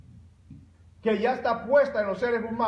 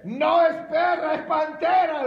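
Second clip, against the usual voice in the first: high, loud shouted correction; hammed-up panicked punchline shout; fleeing Mexican's retort; 7 semitones higher, 5 dB louder than usual. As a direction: yell it back while running away. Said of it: "Y el mexicano le dice".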